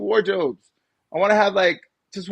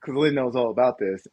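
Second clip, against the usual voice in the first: ordinary delivery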